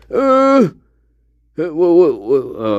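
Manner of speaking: startled stammer. Real, not acted. Streamer startled by game event.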